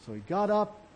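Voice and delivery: ordinary talk, nothing marked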